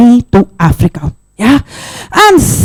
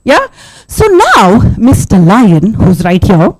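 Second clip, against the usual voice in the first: plain reading voice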